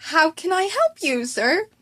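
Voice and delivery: put-on a caught kid trying to sound innocent; nervous high squeak. Student caught by his teacher, nervously high.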